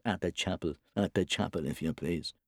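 half to himself, mocking the boy; understated sneering mimicry of a flat accent; mimicking son's flat accent. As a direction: mutter a mock echo of your son. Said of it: "He began to mimic his son's flat accent, saying half to himself".

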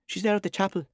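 put-on high child's voice, flat accent; high-pitched boy with a flat accent; little boy's flat accent. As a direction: answer as the small son. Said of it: "his son's flat accent".

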